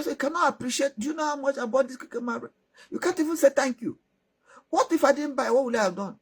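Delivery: a husband stung by ingratitude; rebuking husband. Acted.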